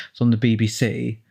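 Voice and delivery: ordinary talk, nothing marked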